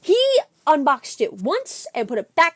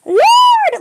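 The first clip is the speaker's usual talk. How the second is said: high, loud, drawn-out shout of thanks; belted, overjoyed praise; joyful praise shout; 11 dB louder, much slower than usual. Retold or imitated. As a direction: cry it out to the heavens, elated. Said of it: "I was literally in my car. I'm like".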